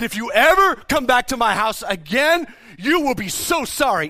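loud, high-pitched warning; retold angry threat. Teenager warning an intruder never to return, re-enacted angry yell. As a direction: re-enact yelling a threat, hitting the key words. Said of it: "I yelled back at him in anger".

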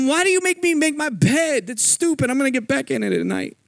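a fed-up rehab patient unloading on his counselor, high and exasperated; exasperated outburst. Retold or imitated. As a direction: let three weeks of annoyance burst out. Blowing up at the counselor Jared.

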